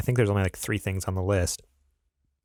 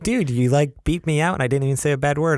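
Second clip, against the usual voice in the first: imitating an indignant complaint, higher and louder; lightly mock-outraged quick objection; retold protest; 6 semitones higher, 3 dB louder than usual. Host imitating co-host complaining about being beeped out, retold protest.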